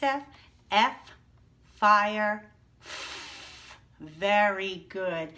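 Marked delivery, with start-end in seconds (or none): 1.8-2.5 "fire" said much louder, F pushed hard; loud key word. Shown as a demonstration. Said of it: "Let's go". 2.8-3.8 "f" as a voiceless hiss, teeth on lip; f sound. Shown as a demonstration. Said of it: "put your teeth on your bottom lip".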